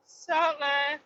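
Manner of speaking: Salt Life sneered high and mocking. Mocking the sticker name.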